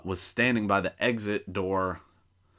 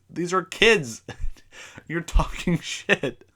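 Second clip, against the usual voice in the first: higher, slow, laughing through it; laughing disbelief; 9 semitones higher, 4 dB louder, slower than usual. Real, not acted. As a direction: laugh at how absurd it was.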